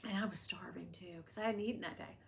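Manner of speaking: breathy confiding whisper; whispered aside. Real, not acted. Vlogger confiding to viewers, whispered. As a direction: whisper it like a secret. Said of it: "aside whispered".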